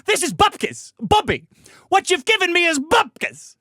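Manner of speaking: put-on hammy old Yiddish man; Yiddish accent.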